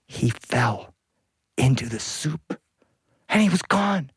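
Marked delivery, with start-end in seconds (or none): 3.3-4.1 shocked narration. A narrator's reading. Narrator shocked by husband's fall.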